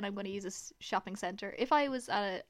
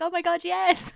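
loud, high mock excitement; gushing reunion; 6 semitones higher, 6 dB louder, slower than usual. Girl spotting her bathroom friend on the dance floor, excited gush. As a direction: show off your new best friend.

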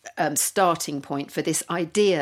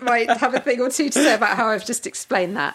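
laughing; 8 semitones higher, 6 dB louder than usual. Real, not acted. Laughing self-mocking comment.

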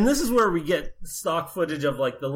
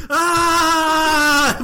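high, louder mock yell; hammed-up scream while running; 10 semitones higher, 5 dB louder than usual. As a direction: yell like a man fleeing down a hill. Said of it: "He's like".

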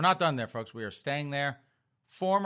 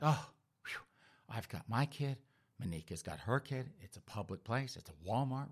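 reassuring self-talk; 2 semitones lower, 8 dB quieter than usual. Acted. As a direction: talk yourself down under your breath, softly. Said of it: "She's like".

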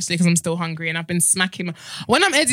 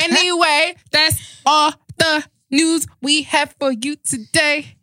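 slow, higher-pitched presenter voice; mock newsreader sign-off; 6 semitones higher, much slower than usual. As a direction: draw it out like a hammed-up news anchor. Mock newsreader sign-off to close show.

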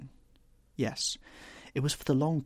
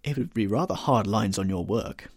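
ordinary delivery

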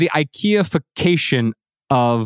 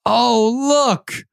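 put-on delighted little boy; 10 semitones higher, slower than usual. Son's delight voiced in story.

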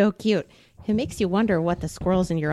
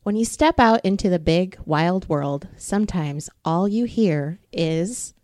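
put-on reading to children. A narrator's reading. Librarian reading a bedtime book to kids, gentle. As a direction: read slowly and tenderly to a child. Reads picture book aloud.

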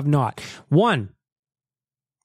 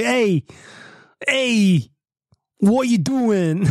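higher, slow New York accent imitation; mock a New Yorker calling out, slowly; 7 semitones higher, much slower than usual. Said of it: "and he's like".

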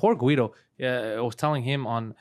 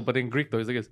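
ordinary talk, nothing marked

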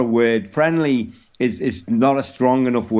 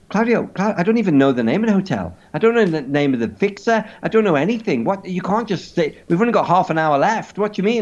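high, fast, flustered protest; mock panic; 6 semitones higher, faster than usual. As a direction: plead in rising acted panic, tripping over words. Acted panicked protest to friend.

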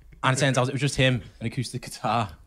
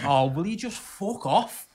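slow, higher, loud telling-off; slow fed-up snap; 5 semitones higher, 4 dB louder, much slower than usual. Retold or imitated. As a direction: drag it out like you've had enough. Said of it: "And I just went".

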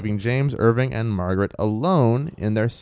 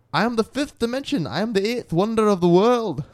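put-on high, squeaky try at a Manx accent; S0 voicing Jeff the wall spirit, a shaky Manx accent; attempted Manx accent; 9 semitones higher than usual. Lightly done high Manx accent for the wall spirit. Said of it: "I don't think I did either of those".

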